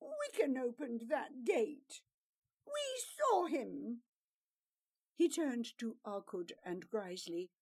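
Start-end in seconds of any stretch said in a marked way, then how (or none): 0.0-4.0 a whiny protest, pitch climbing at the end; whining. A narrator's reading. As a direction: whine it like a caught liar. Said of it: "The bluster turned to whining".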